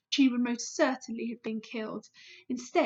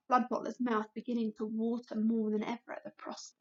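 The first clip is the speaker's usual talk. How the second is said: ordinary delivery, nothing marked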